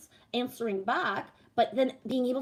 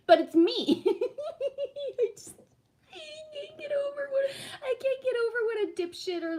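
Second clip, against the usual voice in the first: high, breaking into laughter; can barely get it out for laughing; laughing hard. Real, not acted. Streamer giggling at her own clips, laughing hard. Cracking up at herself.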